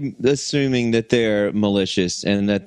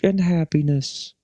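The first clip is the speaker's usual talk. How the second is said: higher, laid-back hippie voice; hippie alien; 7 semitones higher than usual. Retold or imitated. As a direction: mellow stoner alien. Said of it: "They could be like".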